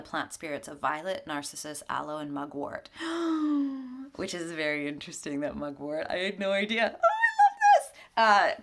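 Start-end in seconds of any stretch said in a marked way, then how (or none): 2.9-4.1 sharp high intake of breath; surprised gasp. Real, not acted. Reader gasping at the mugwort in the card. Reading the plant spirits. 6.9-8.6 squealing high and loud with a laugh; delighted. Real, not acted. Reader thrilled that the card names mugwort, squealing.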